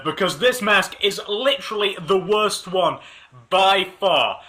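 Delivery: usual speaking voice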